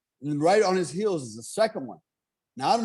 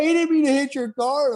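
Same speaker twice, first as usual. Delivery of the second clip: hammed-up pleading wife, pitched way up; imitated ex-wife; 11 semitones higher, 4 dB louder than usual. Ex-wife defending the deer to her husband, imitated in a high wail. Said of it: "she's like".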